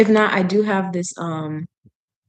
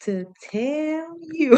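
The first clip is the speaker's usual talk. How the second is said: slow, higher, lightly voiced comic quote; a hint of a comedian quoting his mother; Kevin Hart; 6 semitones higher, 5 dB quieter, slower than usual. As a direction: stretch it out like a comedian's punchline. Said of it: "that Kevin Hart thing where he said".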